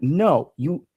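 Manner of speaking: ordinary talk, nothing marked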